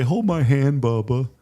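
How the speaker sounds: lightly voiced little girl, sweet and coaxing; a hint of a small sister comforting her brother. Father imitating his daughter comforting her little brother, lightly voiced. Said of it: "She'll say".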